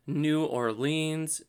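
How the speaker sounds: New Orleans said slowly as "noo or-LEENS"; New Orleans, slow and fully sounded out; full pronunciation. Shown as a demonstration. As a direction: draw out every syllable of New Orleans with a long "leens". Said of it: "depends how you say it".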